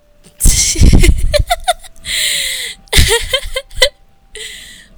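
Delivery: loud, high burst of laughter; real loud laughing fit; big laugh. Real, not acted. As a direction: crack up helplessly.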